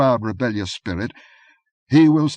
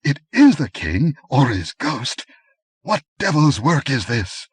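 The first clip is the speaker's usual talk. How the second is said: higher, louder shaken disbelief; a man unsure of his eyes; doubting fear; 7 semitones higher, 5 dB louder than usual. A narrator's reading. Aquilonian facing the king's ghost, frightened disbelief. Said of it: "spoke like a man who doubts his own senses".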